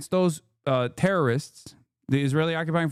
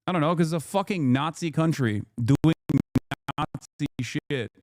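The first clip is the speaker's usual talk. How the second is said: normal talking voice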